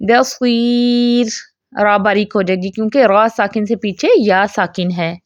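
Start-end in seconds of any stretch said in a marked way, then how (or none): 0.1-1.3 بصیر drawn out very slowly, final ra light; slow Quranic recitation of بصیر with a light ra; recited with a light ra. Shown as a demonstration. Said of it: "لیکن جب ہم وقف کریں گے پھر کیسے پڑھیں گے؟".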